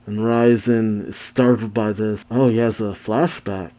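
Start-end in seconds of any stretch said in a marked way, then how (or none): none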